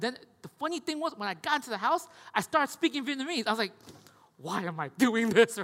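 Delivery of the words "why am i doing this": slow, louder self-questioning disbelief; amused disbelief. Retold or imitated. Preacher mocking his own switch to Vietnamese, slow disbelief. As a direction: catch yourself and laugh at it. Catching himself speaking Vietnamese.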